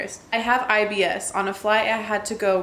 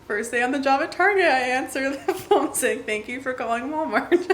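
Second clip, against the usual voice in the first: higher, laughing through the words; laughing reread; 4 semitones higher than usual. Real, not acted. Host rereading a phone blunder she finds hilarious, cracking up. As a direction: barely hold back real laughter while reading.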